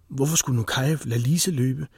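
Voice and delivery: plain reading voice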